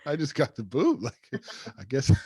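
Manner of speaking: high, loud laugh-filled line. Real, not acted. Laughing to the host about getting the boot from heaven. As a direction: crack up as you say it.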